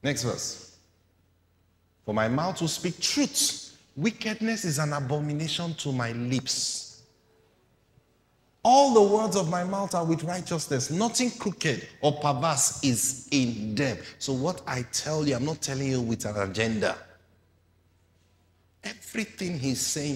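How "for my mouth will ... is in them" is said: higher, louder scripture proclaiming; forceful sermon reading; preaching at full force. Acted. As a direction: proclaim it to the crowd.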